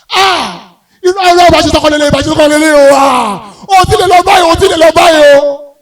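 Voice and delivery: loud, high mock wailing shout; wailing mourner. Comedian acting poor family's loud graveside wailing. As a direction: wail like a grieving poor relative.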